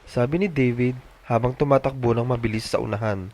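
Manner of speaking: usual speaking voice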